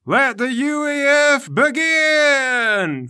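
high, loud, long-drawn proclamation; grand announcement. Acted. Emperor opening the contest to the crowd, grand announcement. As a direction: stretch it out like an arena announcer. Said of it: "He announces".

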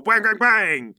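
imitated punches. Game master narrates a combo, loud imitated blows. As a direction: punch out each hit with the voice.

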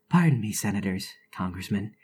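a touch of a sheepish gentleman; polite apology. Acted. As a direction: apologize like a well-mannered intruder.

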